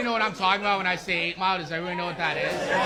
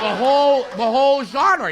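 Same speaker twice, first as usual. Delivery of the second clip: loud emphasis; 4 semitones higher, 8 dB louder than usual. Real, not acted. Building to punchline, louder.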